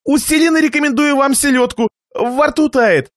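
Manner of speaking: высокий, напряжённо любезный голос; навязывай угощение, повысив голос; настойчивое восклицание. A narrator's reading. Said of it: "сжал под столом кулаки и воскликнул". (натужно-любезно)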